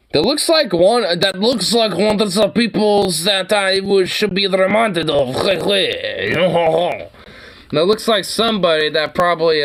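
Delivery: high, gleeful and amused; gleeful excitement. Real, not acted. Excited about guillotine joke.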